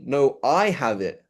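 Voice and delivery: slow contradiction with a long I; I held and emphasized; stress on I. Shown as a demonstration. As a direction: claim it by stressing I. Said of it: "there's intonation".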